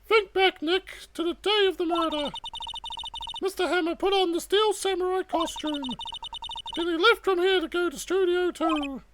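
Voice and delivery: put-on high-pitched, earnest girl sidekick; eager young sidekick. Voices Maya to Nick.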